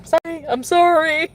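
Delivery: mock apology. Real, not acted. Apologizing to unused potions.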